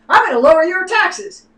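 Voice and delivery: high, loud sarcastic campaign pledge; mocking politician promise. Mocking Republican governors' tax promises, high and loud. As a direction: mimic a smarmy governor's empty promise. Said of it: "every one of them has said".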